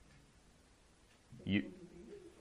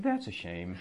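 mock regret; 3 semitones lower, 5 dB quieter, slower than usual. Shown as a demonstration. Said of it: "And I say".

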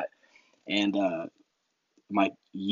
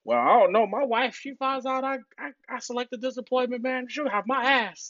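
much higher, whiny sailor voice, slowing at the end; mock scared husband; 13 semitones higher than usual. Mocking the sailor whose wife will be mad, high and whiny. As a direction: play the sailor afraid of his wife.

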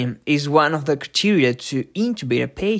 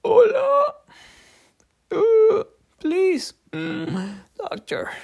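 high, strained, weak pleading; burned patient voice; slower than usual. Shown as a demonstration. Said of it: "live interpretations of a patient get burned".

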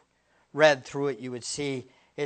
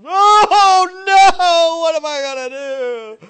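hammed-up crying wail, much higher and louder; mock wailing; 17 dB louder, slower than usual. Preacher parodying someone crying over a tax bill, hammed-up wail. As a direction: overdo the despair for a laugh.

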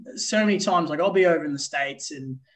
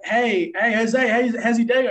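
bright, higher, louder friendly hello; cheery mock greeting; 6 semitones higher, 7 dB louder than usual. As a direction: call out a chipper hello to a teammate. Greeting a teammate, retold.

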